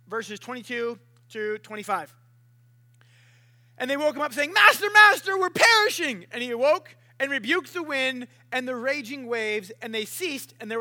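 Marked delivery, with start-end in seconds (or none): 4.5-6.2 high, loud plea for help; urgent, hammed-up yell to wake someone; panicked cry. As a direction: shout it in acted alarm. Disciples wake Jesus in storm.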